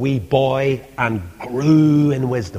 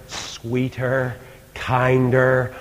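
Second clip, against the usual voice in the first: ordinary talk, nothing marked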